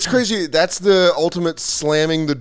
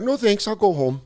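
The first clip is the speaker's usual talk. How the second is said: put-on Canadian accent; 6 semitones higher, 3 dB quieter than usual. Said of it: "And then Canadians are like".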